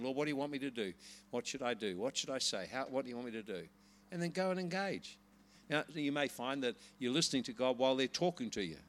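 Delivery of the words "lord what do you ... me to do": soft, low, inward questioning; quiet prayer. Shown as a demonstration. Preacher showing how to listen to the Holy Spirit, quietly praying. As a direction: ask God softly, as if privately tuning in.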